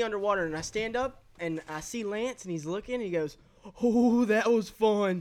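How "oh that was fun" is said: loud, drawn-out whoop; imitated glee. Friend surfacing after boat crash, mock gleeful whoop. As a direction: mimic a thrilled friend, slow and loud.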